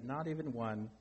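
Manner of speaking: understated storybook sadness; sad. A narrator's reading. Toad to Frog with no cookies left, mildly sad. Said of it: "said Toad sadly".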